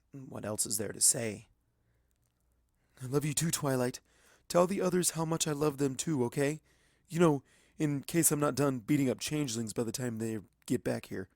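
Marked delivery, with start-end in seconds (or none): 3.0-11.3 put-on higher young dragon, tender farewell; a scared kid dragon saying goodbye; young dragon Spike. A narrator's reading. Spike saying goodbye to Twilight, higher tender voice.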